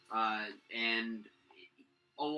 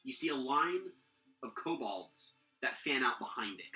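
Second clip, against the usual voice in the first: usual speaking voice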